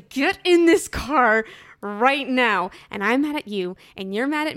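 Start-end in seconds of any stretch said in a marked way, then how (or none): 0.1-2.7 slow, louder retold command; wife ordering her husband into the car, slow and stern. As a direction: spell out every word like a furious mum. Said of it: "I throw open the door and I say".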